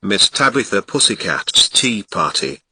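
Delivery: usual speaking voice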